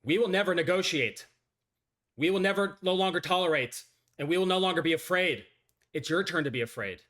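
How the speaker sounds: loud, high, defiant president voice; shouted presidential speech. Acted. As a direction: bellow it like a president declaring war. First read of president speech.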